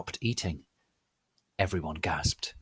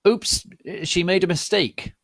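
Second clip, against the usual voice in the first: high, loud hammed-up old wizard; mock Dumbledore; 10 semitones higher, 4 dB louder than usual. Dumbledore covering for Rumbridge, high hammed-up. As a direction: correct her with fake cheer.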